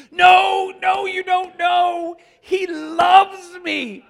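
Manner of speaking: high, loud, slow and stretched; ecstatic, pleading shout; overjoyed insisting. Retold or imitated. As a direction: press her to understand, almost crying out.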